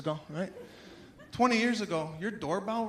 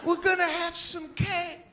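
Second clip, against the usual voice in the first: eager welcome; 8 semitones higher than usual. Retold or imitated. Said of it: "They're like".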